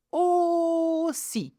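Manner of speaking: "aussi" with the first O held long and high; exaggerated teaching "aussi", ooo-si; long O sound. Shown as a demonstration. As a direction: stretch the "au" of "aussi" into a sustained O. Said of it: "are pronounced O".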